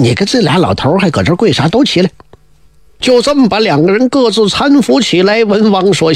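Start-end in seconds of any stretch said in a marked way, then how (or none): none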